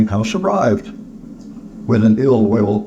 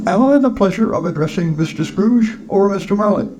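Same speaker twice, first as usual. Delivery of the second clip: high-pitched, courteous gentleman; polite charity collector; 10 semitones higher than usual. Acted. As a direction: inquire politely in a high character voice.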